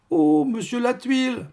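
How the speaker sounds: high, drawn-out, amused exclamation; guffawing concierge. A narrator's reading. Concierge greeting monsieur Latuile, high mock guffaw. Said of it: "s'esclaffa la grosse dame".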